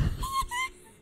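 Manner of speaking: shrill delighted squeal laugh; high squeal of delight. Real, not acted. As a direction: squeal with glee. Squealing at cute gift.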